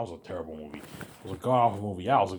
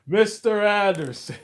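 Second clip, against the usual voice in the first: slow, much higher and louder, each syllable drawn out; a mock movie villain greeting his enemy; imitating Agent Smith; 13 semitones higher, 10 dB louder, slower than usual. The Matrix villain greeting Neo, in a drawn-out mock menace.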